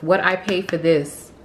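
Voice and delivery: ordinary talk, nothing marked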